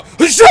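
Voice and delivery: loud high martial arts yell; mighty ki shout. Retold or imitated. Telling a friend how the sensei yelled, a loud ki shout. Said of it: "And then he'd go".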